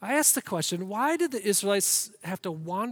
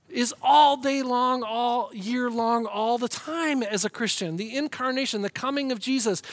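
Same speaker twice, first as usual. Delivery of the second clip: driving, emphatic sermon delivery; impassioned preaching; 3 semitones higher, 5 dB louder than usual. Real, not acted. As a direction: proclaim the gospel with full conviction.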